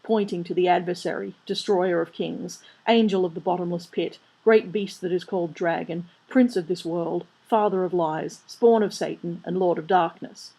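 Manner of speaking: plain reading voice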